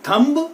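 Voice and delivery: "थंब" with the final b sounded; wrong thumb. Shown as a demonstration. Teacher shows students the common misreading, b sounded. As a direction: pronounce the b ending "थंब". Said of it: "इसको क्या हम थंब पढ़ेंगे?".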